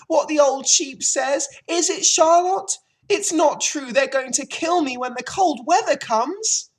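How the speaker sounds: high, loud, panicking piglet; frantic screaming. A narrator's reading. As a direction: plead hysterically at full voice. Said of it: "screamed Wilbur, racing up and down".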